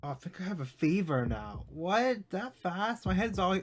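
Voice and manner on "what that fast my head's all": high, startled disbelief; a real little squawk of surprise; surprised. Real, not acted. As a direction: caught off guard by it, pitch jumps up. Shocked at sudden fever.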